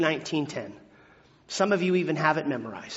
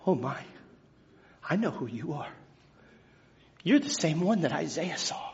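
much softer, awed and low; hushed awe; 4 semitones lower, 9 dB quieter than usual. Acted. Peter on his knees before Jesus, hushed awe. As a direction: barely breathe it, awestruck. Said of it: "He goes".

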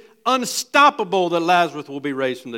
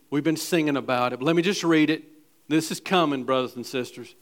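ordinary talk

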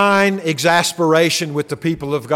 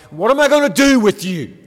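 exasperated mom; 4 semitones higher than usual. Retold or imitated. Preacher imitating his mother scolding him, exasperated. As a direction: voice mom throwing up her hands at a naughty child. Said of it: "in exasperation, sometimes would say things like".

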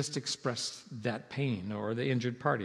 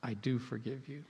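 much softer, tender assurance; a quiet, sincere "I do forgive you"; granting forgiveness; 2 semitones lower, 7 dB quieter than usual. Shown as a demonstration. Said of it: "grant forgiveness".

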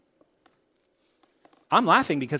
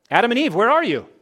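calling out; 7 semitones higher, 10 dB louder than usual. Acted. God's questions quoted in sermon.

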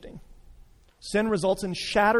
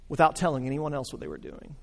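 ordinary talk

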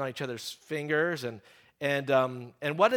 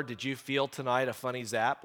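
usual speaking voice

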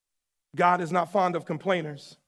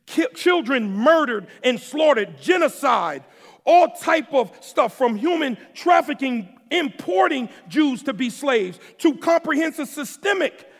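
high, loud preaching at full force; building sermon delivery, loud and high; fiery preaching; 6 semitones higher, 6 dB louder than usual. Acted. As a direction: preach it out to the congregation, hitting every word.

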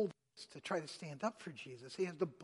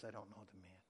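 low, hushed and scared; quiet nervous denial; nervous, much softer denial; 9 semitones lower, 14 dB quieter, faster than usual. Retold or imitated. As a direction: mumble it like a frightened man. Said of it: "he is so worried, so nervous".

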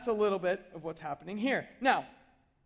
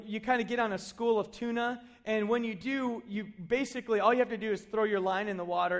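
normal talking voice

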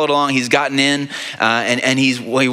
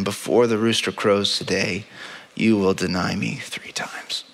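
soft, slow, breathy whisper; hushed whisper; 5 semitones lower, 5 dB quieter than usual. A narrator's reading. Preacher recalls Jesus' words to Peter, whispered. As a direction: let it fall to a hush, as if it can barely be said.

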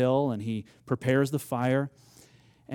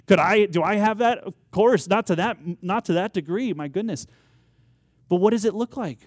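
loud, higher preaching burst; fervent preaching; 6 semitones higher, 6 dB louder than usual. Acted. Preacher confessing his own doubt to the church, fervent preaching. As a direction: raise the voice and press the question.

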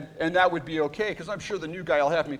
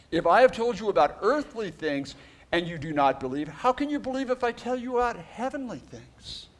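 scripture reading; 5 semitones higher than usual. Acted. Reads scripture aloud.

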